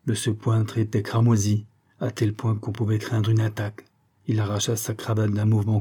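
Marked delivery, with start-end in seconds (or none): none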